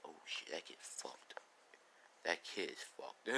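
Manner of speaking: soft, breathy whisper, no voice; inner-thought whisper. Retold or imitated. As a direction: hush it like a private thought.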